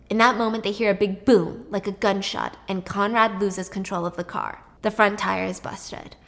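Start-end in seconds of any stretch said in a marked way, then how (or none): none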